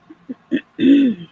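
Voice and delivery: real rough throat clear; loud cough-like throat clear while sick. Real, not acted. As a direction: clear the throat hard to get the voice back. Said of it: "I'll probably be clearing my throat a lot".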